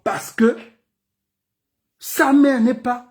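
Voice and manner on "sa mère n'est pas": ordinary talk, nothing marked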